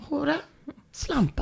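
"Hora" and "Slampa" as soft, high questions; questioning tone. Shown as a demonstration. Host tries the co-host's question-mark theory, questioning tone. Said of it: "ett frågetecken efter hora".

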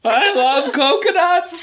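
high, slow, laughing through it; laughing backpedal. Real, not acted. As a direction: take it back while cracking up.

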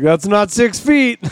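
calling out to strangers. Retold or imitated. Riding past a couple holding hands, a slow teasing call-out. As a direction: slowly call it across the road at a couple. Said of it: "I'll be like".

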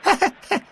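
very high gleeful cackle; boyish laugh. A narrator's reading. Derek laughs at muddy McBain, high and gleeful. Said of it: "and laughed".

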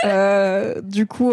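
ordinary talk

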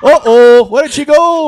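mock Scottish storyteller; Scottish accent. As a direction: ham up a Scottish street joke. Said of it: "street jokes with Scottish accents".

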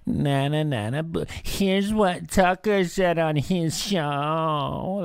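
high, slow whiny mockery of a CNN host; mocking CNN whine. Mocking CNN hosts, a high slow whiny sneer. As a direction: sneer it out like a tattling child. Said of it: "it's just like".

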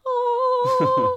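a high sung angelic "Ah"; choir sound. Pretend. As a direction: sing it like a heavenly choir announcing him. Mimics a heavenly choir entrance.

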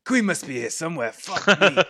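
high, loud lizard voice; searching creature, getting exasperated; flustered lizardfolk character. Acted. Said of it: "He goes".

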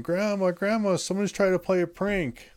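put-on high, excited little kid calling out; kid's voice. A child spots a bloodied stranger at the door and calls out to his grandma, high and excited. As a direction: pitch way up like a small child shouting for grandma. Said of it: "I just hear this kid's voice going".

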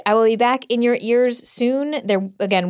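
normal talking voice

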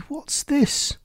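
very high, startled question; the lady asks shrilly in sudden fright; frightened cry. A narrator's reading. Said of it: "screamed the lady".

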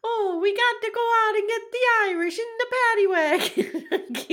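put-on Irish accent that slides into Italian, laughing; bad Irish accent. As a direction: ham up a failed Irish cop voice. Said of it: "My Irish accent sounds like my Italian".

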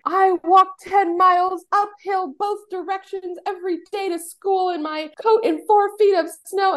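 high, drawn-out mock complaint; old-timer's hardship rant. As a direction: exaggerate it like an elder boasting of hard times. Mock hardship boast of an elder.

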